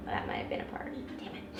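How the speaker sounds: whispered under the breath; whispered regret. Real, not acted. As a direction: hush it out, kicking yourself. Missed casting call, muttered regret.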